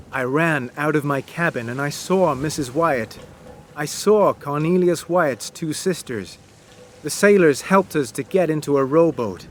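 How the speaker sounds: ordinary delivery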